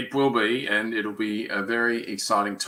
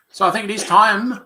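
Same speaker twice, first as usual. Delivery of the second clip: higher, louder segment announcement; upbeat host lead-in to the segment; segment announcer; 10 semitones higher, 11 dB louder than usual. Real, not acted. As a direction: hype up the segment intro like a host.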